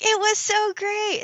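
high, slow, over-the-top gushing; mock gushing. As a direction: gush it like a dreamy parent. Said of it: "You're like".

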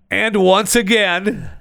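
high, slow and stretched; drawn-out announcer. Acted. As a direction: stretch it out like a showman. Drawn-out emphatic opener.

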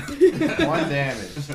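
drawn out, squeaky and high; mock disbelief. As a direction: stretch it out in disbelief. GM mocks the tiny damage.

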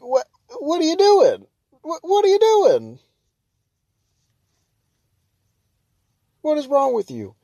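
high, loud disbelieving outburst; outraged disbelief. Retold or imitated. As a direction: demand what he's thinking. Repeated outraged question at driver.

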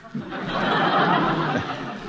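short airless laugh; dealer's breathy laugh at the joke. Acted. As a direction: scoff out a laugh under the breath.

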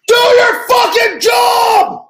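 imitated coach screaming. Coach screaming at his quarterback on the phone, furious yell. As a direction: bellow it like a coach losing it.

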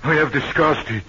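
high, loud feverish rambling; 1 delirious. Acted. Malaria-stricken prisoner raves about Eloise, high and loud. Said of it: "getting delirious again".

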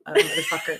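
very high, laughing squeak. Real, not acted. Confessing to her partner what she called stepmom, laughing. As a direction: crack up while getting the word out.

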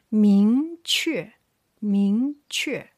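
明确 with 明 rising loudly, 确 falling; second-plus-fourth tones. Shown as a demonstration. Teacher drills second with fourth tone, 明确 loud rise then fall. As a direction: push 明 upward with force, then drop 确. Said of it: "second tone with the fourth tone".

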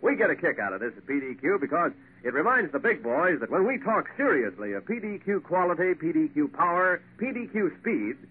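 ordinary talk, nothing marked

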